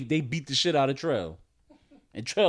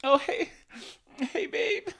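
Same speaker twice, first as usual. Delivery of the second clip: high, laughing mock greeting from a crying girlfriend; teary girlfriend; 14 semitones higher, slower than usual. A crying girlfriend greets her man at the door, laughing. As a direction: play her sniffling hello through laughs. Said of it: "She look up like this, she go".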